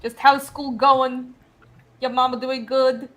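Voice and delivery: put-on slow, lightly done Boston accent; a weak try at a Boston accent. Mock Boston accent for the panel, only lightly done. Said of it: "the Bostonian accent".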